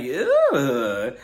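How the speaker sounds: drawn-out, grossed-out "ew"; disgusted ew. Acted. Host reacting to mud smeared on a lip, disgusted. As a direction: recoil in exaggerated disgust.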